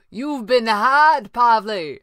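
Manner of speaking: high, loud, drawn-out taunt; calling out. A narrator's reading. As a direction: call down from high above. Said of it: "the red panda called".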